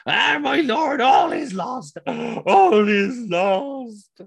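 high, loud, hammed-up wail of despair; over-the-top tragic messenger; melodramatic lament. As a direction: wail it, then drag it out hugely to a collapse. Messenger's dying lament as ship sinks.

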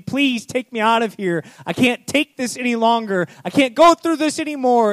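high, loud desperate pleading; desperate clay. Acted. Clay crying from the oven to potter, high and desperate. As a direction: cry out from the heat.

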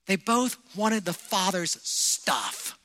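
ordinary delivery

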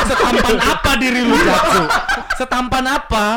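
high-pitched incredulous question through laughter; cracking up while challenging him; laughing teasing. Real, not acted. Host teases guest who picks his own face, laughing incredulously.

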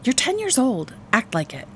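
high start dropping to a firm end; exasperated mother telling off her son; exasperated scolding. A narrator's reading. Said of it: "Mom looked exasperated".

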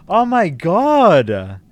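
high, loud, drawn-out yell of astonishment; amazed yelling. Real, not acted. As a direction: yell it slowly in real disbelief, stretching every word. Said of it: "sorry for yelling".

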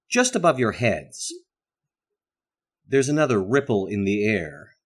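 usual speaking voice

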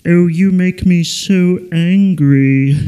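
slow, drawn-out cartoon villain anger; Marvin the Martian. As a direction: seethe slowly in a put-on cartoon voice. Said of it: "he goes like this".

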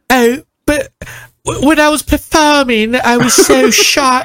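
high-pitched, dainty British impression; hammed-up British accent, drawing out the end; mock British accent. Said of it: "every time I heard a British".

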